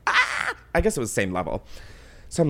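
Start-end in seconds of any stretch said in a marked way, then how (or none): 0.1-0.5 excited squeal. Retold or imitated. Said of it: "I was like".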